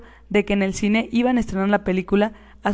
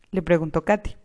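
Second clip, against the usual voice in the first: plain reading voice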